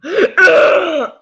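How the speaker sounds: loud, high, over-acted groan; hammed-up exasperated groan; dramatic mock groan. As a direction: groan theatrically, far too much. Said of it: "I was dramatic".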